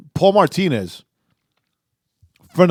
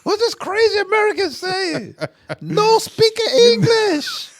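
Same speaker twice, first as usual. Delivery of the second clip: much higher, laughing put-on voice; mocking the confused locals, high and laughing; slower than usual. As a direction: squeak it out through laughter. Mock Korean locals, laughing.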